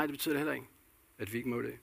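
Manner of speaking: ordinary talk, nothing marked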